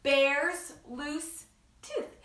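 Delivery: slow, stretched title; slow title read. Shown as a demonstration. Announcing the book title to children, stretched slowly. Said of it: "book called".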